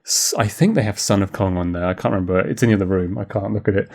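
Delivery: usual speaking voice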